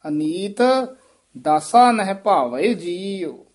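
Aneet, pause, then slow drawn-out phrase; halt after Aneet. Shown as a demonstration. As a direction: separate Aneet, stretch what follows. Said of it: "ਇੱਥੇ ਯਮਕ ਲਾ ਦੇਣੀ ਹੈ".

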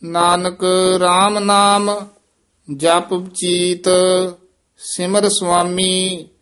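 slow, measured chant with held endings; teacher's deliberate Gurbani reading; scripture recitation. Acted. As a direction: intone sacred verse phrase by phrase.

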